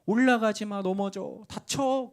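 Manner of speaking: frantic parent calling stop; retold shout. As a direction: shout up at the climbing kids. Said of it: "제가 또 소리 지릅니다".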